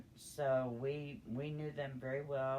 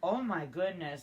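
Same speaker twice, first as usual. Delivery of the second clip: shocked exclamation; 5 semitones higher, 8 dB louder than usual. Real, not acted. Reacting to manure fire.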